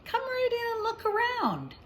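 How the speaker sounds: high, hearty, welcoming call; jolly teacher. Acted. Teacher inviting Sister into the classroom, high and jolly. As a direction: sweep the little one inside with cheer. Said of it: "in a loud, jolly voice".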